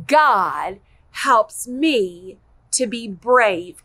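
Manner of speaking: slow, deliberate, every word spelled out; slow model line. Shown as a demonstration. Teacher modelling the memory verse for kids, slow and clear. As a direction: model the line slowly for children to copy. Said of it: "Can you say that with me?".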